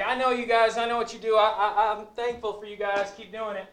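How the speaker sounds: eager student. Retold or imitated. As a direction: burst out with friendly enthusiasm. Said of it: "Austin's like".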